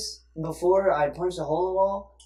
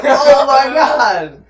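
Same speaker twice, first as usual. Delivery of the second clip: loud, high, drawn-out mock dismay; laughing, hammed-up horror; shocked realization; 8 semitones higher, 14 dB louder, much slower than usual. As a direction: act out realizing you ignored your guest. Acted shock at having ignored visitor.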